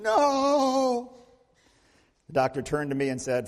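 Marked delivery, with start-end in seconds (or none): 0.0-1.1 mild mock scream. Girl still refusing the doctor.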